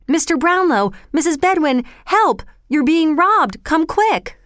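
high, urgent calls of alarm; shouting for help. A narrator's reading. Oliver alerts the household to robbers, high urgent shout. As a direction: raise the alarm through the house. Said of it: "he shouted".

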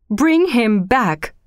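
slow, emphatic plea; crying out. A narrator's reading. Old woman pleading for her dead son, crying out. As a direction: draw out every word urgently. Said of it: "cried the old woman".

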